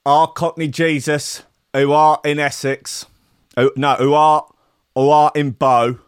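lightly Cockney, a mock prayer delivered like a pub sermon. Host prays to Cockney Jesus in mock Cockney accent. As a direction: pray in Cockney to Cockney Jesus, half in jest. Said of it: "We could do a little Cockney Jesus prayer".

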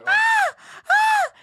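very high, loud yelps; two sharp screams of pain. Real, not acted. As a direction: scream as it stings. Eyelashes being pulled, eyes stinging.

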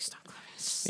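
hushed whisper; breathy whisper to the crowd. Retold or imitated. Imitating Joaquin hushing the crowd.

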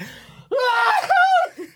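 high wild yell out the window; cry shout. Shown as a demonstration. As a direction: let rip with a high whoop. Demo of yelling out window.

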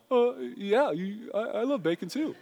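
high, halting talking-dog voice; dubbed dog voice. Acted. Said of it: "you hear the voice going".

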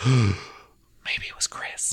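slow hushed whisper of realization; whispered mock detective realization; dramatic whisper. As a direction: breathe it out slowly like a revelation. Cops' sudden realization.

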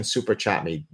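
usual speaking voice

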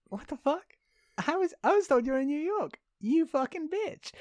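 high, squeaky mock outrage; imitating the hero's outrage at Kareem, pitched high; mock outraged Tom Hanks. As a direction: play him as shrill and indignant. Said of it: "Tom Hanks is like".

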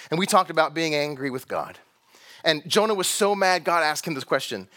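usual speaking voice

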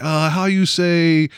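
slow, drawn-out mock French accent; lightly put-on French accent. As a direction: drag out how you say like a Frenchman. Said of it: "he's like".

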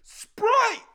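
high-pitched, loud shout of one word; mock yell. Man yelling from a car around the neighborhood, mock yell. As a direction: yell it out like a random passerby. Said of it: "occasionally yelling, like".

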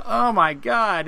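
a stretched-out, delighted gasp of words; amazed. Retold or imitated. Fan spotting Chewie behind him, slow and excited.

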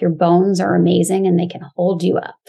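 ordinary talk, nothing marked

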